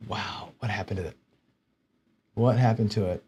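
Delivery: very soft, low disbelief; baffled self-talk. Real, not acted. Streamer staring at a missing file, hushed and baffled. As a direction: wonder quietly to yourself, stunned.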